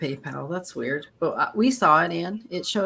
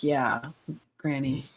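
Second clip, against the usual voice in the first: normal talking voice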